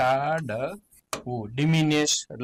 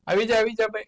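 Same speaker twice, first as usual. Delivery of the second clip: cheering; 6 semitones higher, much faster than usual. Real, not acted. Cheering a right answer.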